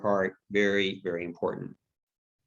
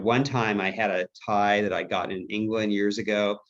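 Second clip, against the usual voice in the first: ordinary talk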